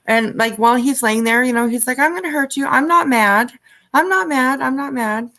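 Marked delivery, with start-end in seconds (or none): 2.0-5.3 high, lightly voiced imitation of a falsely calm father; sing-song fake reassurance, imitated; mock soothing father. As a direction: mimic him cooing that he's not mad. Imitating father reassuring his son.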